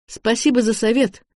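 testy, sarcastic retort; язвительное ворчание. A narrator's reading. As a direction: snap back as if insulted by the advice. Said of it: "буркнул Макс". (язвительно и раздражённо)

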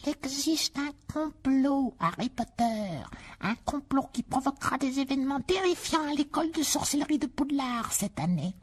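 slow, high elf murmur; slow, secretive high elf voice. Acted. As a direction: let the elf confide the plot slowly. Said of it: "murmura Dobby".

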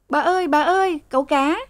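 high-pitched frantic calling; shrill cry. A narrator's reading. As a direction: call out high and piercing. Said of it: "Sen the thé". (giọng the thé, gọi thất thanh)

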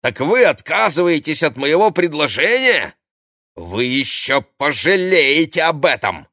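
high, loud threat; indignant. A narrator's reading. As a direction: flare up and warn them off. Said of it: "возмутилась Ксения".

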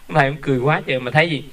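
usual speaking voice

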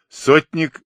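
ordinary delivery

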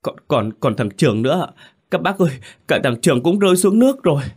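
put-on boy remembers the other friend, stammering then crying out; trembling voice. A narrator's reading. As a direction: stammer that builds to a high, loud cry. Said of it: "tôi run run nói".

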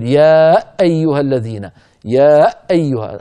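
يا stretched, then a hard punched glottal stop opening أيها; over-stressed hamza. Shown as a demonstration. Teacher deliberately shows the fault, hamza over-stressed. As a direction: hit the hamza of أيها forcefully after a long يا. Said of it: "تعمدت الآن أنا تشديد الهمزة".

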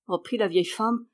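ordinary delivery

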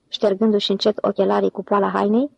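plain reading voice

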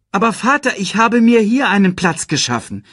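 high, loud youthful objection; pleading protest. A narrator's reading. Simba argues with his father. (hoch und laut widersprechen)

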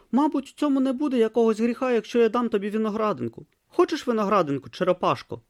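put-on high, earnest young novice voice; a naive boy talking to a turtle; young novice Brutha. A narrator's reading. Young novice offers the tortoise lettuce, high and earnest.

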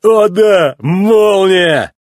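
loud, high jeering whoop; mock cheer. A narrator's reading. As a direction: shout it like a teasing crowd. Mechanics mockingly cheering the rival.

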